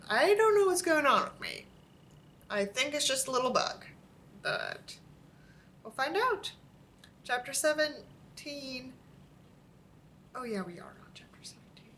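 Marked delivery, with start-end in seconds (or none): 0.1-1.7 exasperated outburst. Real, not acted. Venting to viewers about feeling unwell, exasperated. Baffled at her own state. 10.4-11.9 quick sarcastic whisper; whispered aside. Real, not acted. Reminding viewers which chapter of the bad story, whispered.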